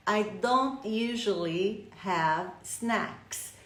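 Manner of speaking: spaced-out English model, word by word; careful, slow teaching delivery; slow example sentence. Shown as a demonstration. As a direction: read "I don't usually have snacks" slowly. Teacher reads example sentence slowly.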